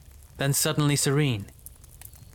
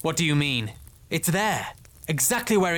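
rising, agitated denial; alarmed insistence; 3 semitones higher than usual. Acted. Lieutenant denies the news of Siltarian's fall, alarmed. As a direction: insist with growing force, then settle at the end.

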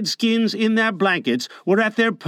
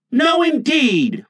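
louder, higher, slow no; firm refusal; 8 semitones higher, 4 dB louder, slower than usual. A narrator's reading. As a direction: twins flatly refusing together. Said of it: "replied the twins".

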